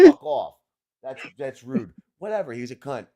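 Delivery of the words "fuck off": a sudden loud mock bark of fuck off; mock shout. Host quoting Savini's insult, loud mock shout. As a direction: shout it at the fan in imitation. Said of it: "You said".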